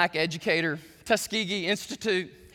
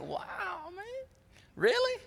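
amazed; 13 semitones higher, 5 dB quieter, slower than usual. Retold or imitated. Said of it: "folks in the cafeteria are like".

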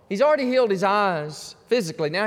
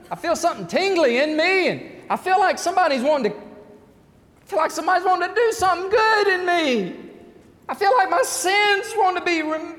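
rising from plain to high and loud; a stirred newcomer getting louder and higher; excited seeker; 12 semitones higher, 6 dB louder than usual. Pretend. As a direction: build the questions into an urgent cry. Voicing a seeker's questions, rising.

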